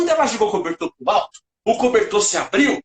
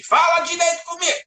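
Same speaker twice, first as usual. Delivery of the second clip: scolding shout; 10 semitones higher than usual. Retold or imitated. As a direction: snap it like a cross grandma. Grandma scolding, slaps bottom.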